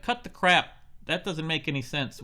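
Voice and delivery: loud high snap; the boy losing patience with the baker; snapping kid. Acted. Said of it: "Tyler's like".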